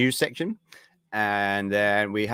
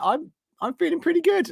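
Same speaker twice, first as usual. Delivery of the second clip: much higher playful squeak; squeaky high. Real, not acted. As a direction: jump up into a silly high voice. Chirpy high self-report.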